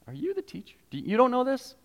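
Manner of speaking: mock-shocked and high; incredulous. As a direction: act amazed at the teacher's ignorance.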